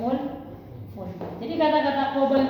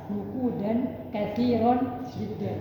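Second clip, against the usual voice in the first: slow teaching read; much slower than usual. Shown as a demonstration. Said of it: "Madza hamalta?".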